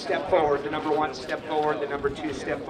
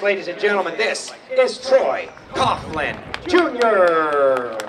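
loud announcer call with stretched-out names; arena announcer introduction; 6 dB louder, slower than usual. Real, not acted. Announcer presents champions to the crowd, big ringside introduction. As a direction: draw out each star's name for the crowd.

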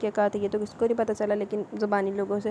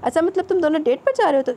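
high, loud, amused teasing; gleeful knowing jibe, pitched up; 7 semitones higher, 5 dB louder than usual. A narrator's reading. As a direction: rib your friend about the date. Friend teases him on the phone.

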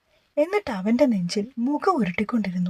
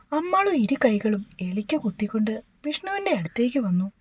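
usual speaking voice

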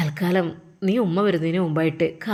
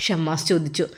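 ordinary delivery

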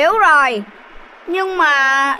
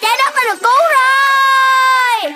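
higher joyful shouting; victory cheer; 6 semitones higher, slower than usual. Acted. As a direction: celebrate that the earth is saved. Victory cheer after saving earth. (reo mừng cao giọng)

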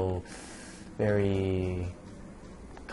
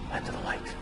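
mock ominous whisper; 6 dB quieter than usual. Whispered counter to quote.

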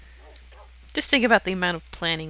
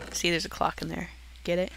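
ordinary delivery

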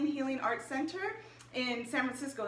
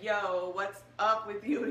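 lower, slow, lightly voiced boyfriend; a hint of a laid-back boyfriend, slow and lower; imitating boyfriend; 4 semitones lower, slower than usual. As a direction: drop the voice a bit and drawl it like a laid-back guy.

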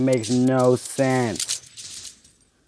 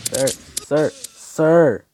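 loud, rising higher calls of sir; calling out; 6 semitones higher, 9 dB louder, much slower than usual. Real, not acted. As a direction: shout to get his attention. Calling out to enemy player.

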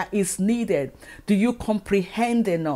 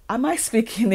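usual speaking voice

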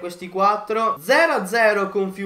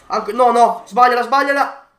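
anxious pleading; 2 semitones higher, 7 dB louder than usual. Real, not acted. Opponent about to score.

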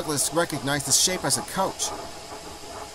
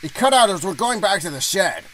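higher-pitched driver voice cursing the cold; grumbling engine driver; 7 semitones higher than usual. Acted. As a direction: explain a breakdown as an annoyed driver.

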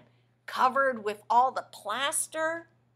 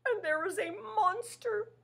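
high, quavering monster wail; terrified monster warning the reader; scared Grover; 8 semitones higher, 3 dB louder than usual. Acted. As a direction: tremble with fear. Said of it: "says Grover".

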